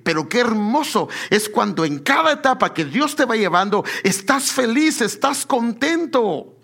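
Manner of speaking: impassioned sermon climax; fervent preaching. Acted. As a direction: drive the point home to the congregation. Preacher rises to full force.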